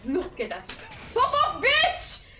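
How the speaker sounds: ordinary talk, nothing marked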